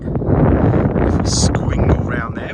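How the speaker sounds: slow breathy whisper. Real, not acted. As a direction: hush it out, drawn slow. Camera tour, whispered start.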